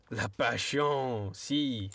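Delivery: mock French accent, drawn out slowly; a playful French accent on La passion. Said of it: "I read that in a French accent".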